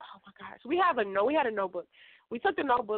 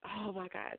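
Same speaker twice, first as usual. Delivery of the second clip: usual speaking voice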